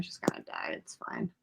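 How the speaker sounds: breathy whisper, no voice; whispered aside. Real, not acted. Whispering a grim aside to her friend. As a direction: breathe it out deadpan. Quiet aside while watching.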